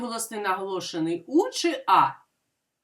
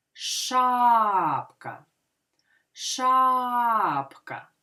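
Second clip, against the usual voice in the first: long drawn-out ША in Шапка; stress on first syllable; much slower than usual. Shown as a demonstration. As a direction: stretch ША, let the end of Шапка drop. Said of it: "І визначимо наголос".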